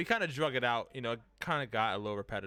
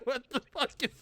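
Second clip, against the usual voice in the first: laughing; 11 semitones higher than usual. Retold or imitated. Quoting a chat comment, laughing.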